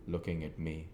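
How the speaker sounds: usual speaking voice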